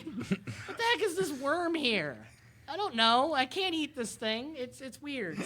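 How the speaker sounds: high, puzzled goose talking. Acted. Goose voiced in the game story.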